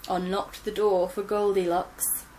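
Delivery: ordinary delivery, nothing marked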